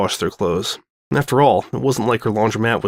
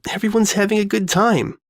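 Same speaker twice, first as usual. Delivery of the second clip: cheerful high voice; 9 semitones higher than usual. Acted. Sanada's cheerful remark.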